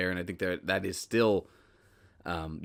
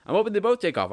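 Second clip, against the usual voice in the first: pitched way up and louder, eager; excited hope; 11 semitones higher, 5 dB louder, faster than usual. Real, not acted. Podcaster hoping both his shows succeed, excited and high. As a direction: lift it high with real eagerness. Hopeful aside about his two projects.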